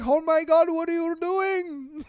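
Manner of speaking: loud, high-pitched mock Middle Eastern accent; hammed-up outraged Middle Eastern driver; Middle Eastern man. Upset driver to the truck that he hit, mock Middle Eastern outrage. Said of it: "The Middle Eastern guy".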